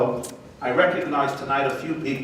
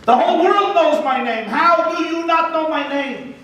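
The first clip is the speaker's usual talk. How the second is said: much higher, louder indignant outburst; outraged; 7 dB louder than usual. Acted. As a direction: erupt in wounded vanity. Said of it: "He's now outraged".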